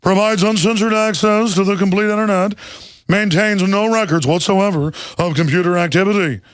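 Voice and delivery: Ted Knight voice. Pretend. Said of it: "the Ted Knight voice".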